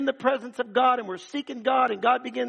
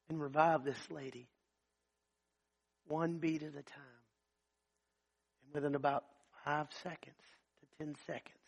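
hushed climax; 5 semitones lower, 6 dB quieter than usual. Acted. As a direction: pull the room in with a near-murmur. Dramatic hushed climax of story.

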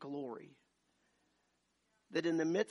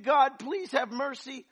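high, loud, slow cry for mercy; loud, slow, screamed plea; 11 semitones higher, 13 dB louder, slower than usual. Retold or imitated. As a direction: beg God at the top of your lungs. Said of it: "I'm screaming".

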